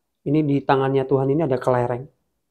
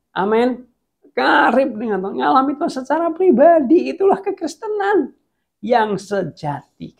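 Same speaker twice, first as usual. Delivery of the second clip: preaching climax; 11 semitones higher, 4 dB louder than usual. Real, not acted. Preacher urging his church toward true closeness with God. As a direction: drive it home to the church.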